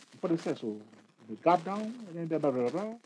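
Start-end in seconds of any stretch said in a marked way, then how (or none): none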